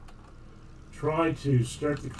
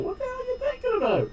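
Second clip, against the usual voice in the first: mock exasperation; faster than usual. Exasperated at tutorial makers.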